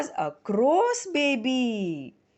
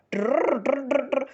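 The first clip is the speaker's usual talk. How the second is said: rolled trrr trill; bird trill; 4 semitones higher than usual. Pretend. Storyteller trills like a baby bird. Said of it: "Their voices also sounded different".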